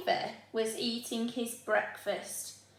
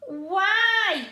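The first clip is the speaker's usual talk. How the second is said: much higher, louder question; child asking; 10 semitones higher, 8 dB louder than usual. Acted. Oliver asking Mum why, high and loud. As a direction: ask like a curious boy. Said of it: "asked Oliver".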